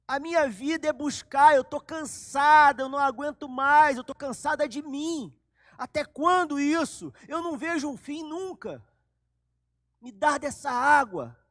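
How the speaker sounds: loud, high, worn-out cry; weary desperate plea. Acted. Samaritan woman telling Jesus she is exhausted, loud and high. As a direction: cry out that you can't take it anymore.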